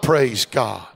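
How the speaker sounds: high, loud preacher's climb; rising sermon cry; preaching at full force. Acted. Preaching peak.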